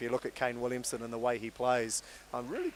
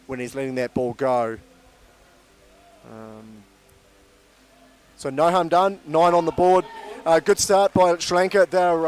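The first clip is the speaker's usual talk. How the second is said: louder, lively cricket call; live play-by-play; 6 dB louder than usual. Acted. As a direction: drive the ball-by-ball call.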